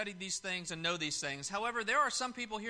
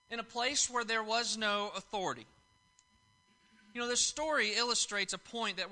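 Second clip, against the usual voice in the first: raised, louder pulpit delivery driving the point home; preaching; 5 semitones higher, 4 dB louder than usual. Acted. Preacher to congregation on authority, raised pulpit voice. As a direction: preach it to the congregation with building force.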